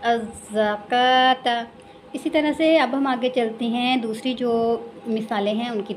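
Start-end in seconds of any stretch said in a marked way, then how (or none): 0.0-1.5 अज़्ज़कात slowly, doubled z and long kaa; silent waw. Shown as a demonstration. Teacher models word for learners, slow recitation. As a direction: hold kaa in अज़्ज़कात, no w sound. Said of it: "तो क्या पढ़ेंगे".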